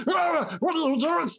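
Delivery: high, startled goblin cry; alarmed goblin. Acted. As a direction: yelp at the other goblin. Goblin cries out at ally.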